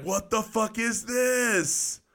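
slow, high-pitched bewilderment; baffled exclamation. Retold or imitated. Young private answering his sergeant about the toilet, baffled high cry. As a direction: stretch it out high in mock disbelief.

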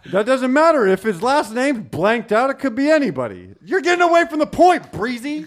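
very high, loud laughing objection; laughing protest. Real, not acted. Arguing with co-host, laughing.

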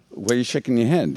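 ordinary delivery

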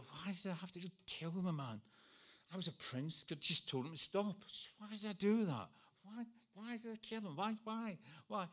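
high, pleading self-questioning; anguished regret. Acted. Moses berates himself over killing the man, in high-pitched anguish.

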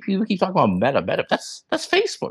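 rising, incredulous realization; sudden dawning surprise; surprised realization. Retold or imitated. Retold realization, pitch rising.

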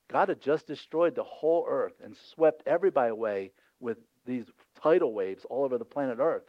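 ordinary talk, nothing marked

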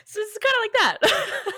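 high, loud, through laughter; cracking up. Real, not acted. Co-host wrapping up their own silly impression, cracking up. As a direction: barely get it out for laughing.

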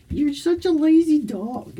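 high teasing coo. Real, not acted. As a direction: tease the lazy dog up high. Talking to her dog.

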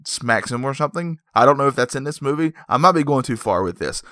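ordinary delivery

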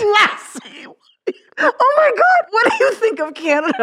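very high, incredulous squeal; helpless laughter. Real, not acted. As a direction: shriek it through helpless laughter. Cracking up at the botched accent.

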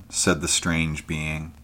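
plain reading voice

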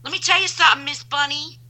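high-pitched sassy neighbor; hammed-up telling-off; imitated neighbor. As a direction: pitch it up like a neighbor giving advice. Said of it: "She goes".

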